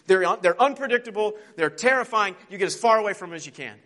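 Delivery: high, loud panicked rush; terrified. Acted. Horse Bree bolting from the lion, loud panic. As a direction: blurt it out in fear, fast at the end. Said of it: "He's terrified".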